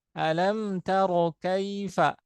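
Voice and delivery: slow recitation of Alam taro kaifa; recited example. Shown as a demonstration. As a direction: draw it out, breath flowing on kaf. (dibaca pelan seperti tilawah)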